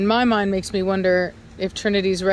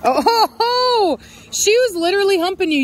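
laughing; 10 semitones higher, 4 dB louder than usual. Real, not acted. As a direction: laugh it out, amused. Laughing at dog humping her.

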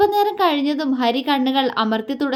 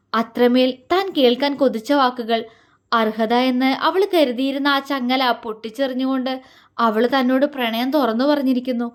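usual speaking voice

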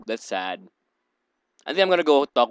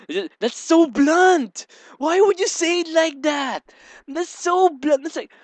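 much higher, loud incredulous protest; shocked outrage; 4 dB louder than usual. Real, not acted. Gamer reacting to Lily's nickname, high shocked disbelief. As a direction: gasp at how rude that nickname is.

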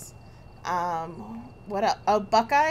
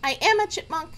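helium voice; 8 semitones higher, 5 dB louder than usual. Real, not acted. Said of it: "Does [name] sound like a chipmunk?".

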